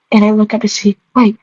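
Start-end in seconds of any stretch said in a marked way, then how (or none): none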